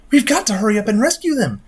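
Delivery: she begs for a plan in a panic; panicked girl voice. Acted. Girl panics about friends' execution.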